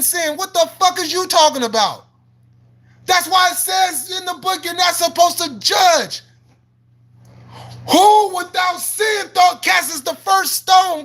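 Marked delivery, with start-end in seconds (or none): 3.1-11.0 raised-voice sermon at the viewer; shouted scripture. Real, not acted.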